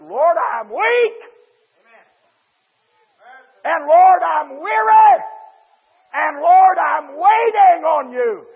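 high, drawn-out preacher's shout; preached cry to God. Retold or imitated. Preacher voices a weary man crying to the Lord, shouted. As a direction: cry out to the Lord, long and loud. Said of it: "and said".

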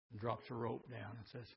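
ordinary talk, nothing marked

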